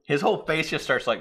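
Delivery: ordinary talk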